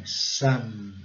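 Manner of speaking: "Some" with a strong, held M; "Some" like "summm", lips closing on the M; strong final M. Shown as a demonstration. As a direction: hum the M at the end of "Some". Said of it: "Caracterizando bem forte o M".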